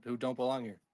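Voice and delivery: ordinary talk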